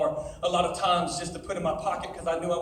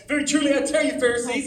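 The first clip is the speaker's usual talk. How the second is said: lifted, louder preaching read of scripture; 6 semitones higher, 6 dB louder than usual. Acted. Preacher reading scripture to teens, louder and higher. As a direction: read the Bible out to the youth, rising.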